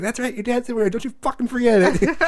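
high-pitched, gleeful taunt breaking into laughter; mom cracking up. Retold or imitated. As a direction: imitate a mom teasing through her laughter. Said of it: "my mom's just cracking up".

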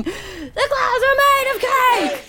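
high, drawn-out gleeful chant; slow, high-pitched cheer; too excited. Acted. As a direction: holler it like an over-excited crowd. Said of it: "still too excited going".

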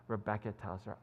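normal talking voice

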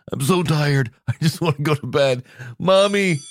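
put-on high, whining little kid; whiny tired child. Host playing a sleepy child calling for mommy, whining. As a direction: whimper like an exhausted toddler.

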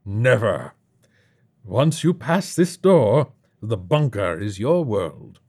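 slow, loud, grandly final pronouncement; imperious bunker host. Acted. Host tells newcomer there is no return, imperiously. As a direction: lay down the law theatrically, drawing out the words.

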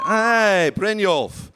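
loud, high Scottish game-character hail; Brynjolf. Acted. Actor recalling the Skyrim thief, loud Scottish Brynjolf voice. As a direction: call it out with a bold Scottish burr. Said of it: "sounds a little bit like, um, uh, Brynjolf".

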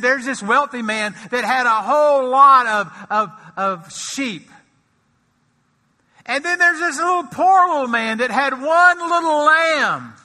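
high, loud storytelling as the prophet; prophet's parable. Acted. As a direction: tell the parable with rising heat. Prophet voiced telling parable.

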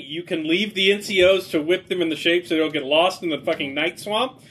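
ordinary talk

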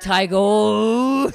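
tiger growled with a rolling r, loud and high. Retold or imitated. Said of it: "I would have to say tiger and growl like a tiger".

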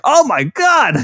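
amazed. Retold or imitated. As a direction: react like you can't believe it. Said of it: "which was just like".

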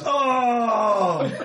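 a high crying shout of "Oh!"; anguished scream. Acted. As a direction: wail it out in pain. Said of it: "And Will screams".

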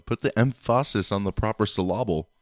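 emphasis as em-FAH-sis, syllable as sil-LAH-bul; deliberately misplaced stress. Acted. Co-host joking about pronunciation, emphasis and syllable stressed on the middle. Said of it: "put the emphasis in the right place".

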